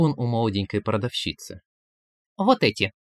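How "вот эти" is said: put-on high, helpful girl's voice; obliging salesgirl. A narrator's reading. Young vendor to her customer, high and obliging. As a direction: offer it sweetly as a shop girl. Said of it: "услужливо подсказала девушка".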